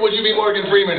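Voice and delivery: normal talking voice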